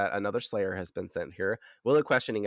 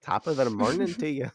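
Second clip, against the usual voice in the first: higher-pitched mock Irish lilt; playful, hammed-up Irish brogue; Irish accent; 5 semitones higher than usual. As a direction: do a stock Irish greeting voice. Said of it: "some fucking Irish accents".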